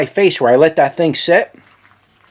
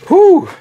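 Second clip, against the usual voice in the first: high, loud whew from the burn; real pain exhale after a super hot pepper; sharp burning exhale; 6 dB louder than usual. Real, not acted. Reacting to pepper heat.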